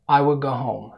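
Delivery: "I will go home" at everyday pace, L of will dropped; natural speed. Shown as a demonstration. Teacher shows learners the middle, natural speed. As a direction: run "I will" together at normal speed. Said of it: "السرعة الطبيعية".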